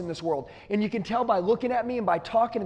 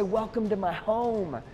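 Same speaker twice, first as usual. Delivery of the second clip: slow, softer, inviting welcome; welcoming; 4 dB quieter, slower than usual. Shown as a demonstration. Salesman greeting a car buyer at the door, slow and welcoming. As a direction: greet them like a guest in your own home, slowly. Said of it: "I say".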